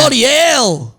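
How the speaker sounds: high, drawn-out Aussie exclamation; hammed-up Australian outburst; Australian accent. [name] demonstrates Aussie swearing for the host, in a high, drawn-out Australian accent. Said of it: "You're good at accents".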